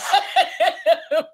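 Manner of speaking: high bursting laugh. Real, not acted. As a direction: laugh hard mid-story. Laughing while retelling.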